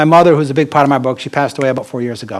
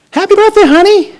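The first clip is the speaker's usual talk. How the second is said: much higher and louder, a doting mom; mother's voice; 8 dB louder than usual. Retold or imitated. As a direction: coo it like a loving mother. Mother voiced on his birthday.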